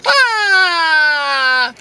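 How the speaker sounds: mock cry. Mock anguish at being ditched.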